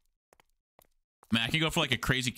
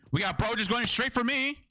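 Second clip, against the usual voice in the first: alarmed; 11 semitones higher than usual. Real, not acted. Enemy rushing him.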